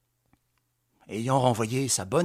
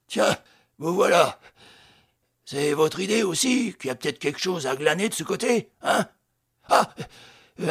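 needling police inspector; 5 semitones higher, 5 dB louder than usual. A narrator's reading. Inspector Moléon needling Victor.

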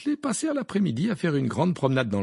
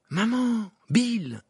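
higher surprised call; astonished cry; 12 semitones higher, 4 dB louder, much slower than usual. A narrator's reading. Ron spotting his mother and Bill, astonished. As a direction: cry out, stunned to see them. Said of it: "s'écria Ron abasourdi".

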